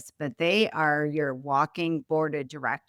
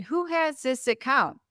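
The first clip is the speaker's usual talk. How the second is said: sharp, higher demanding question, retold; 8 semitones higher than usual. Boss demanding in the weekly team meeting, sharply raised. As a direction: call out to the room, wanting an answer.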